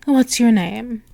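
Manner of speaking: usual speaking voice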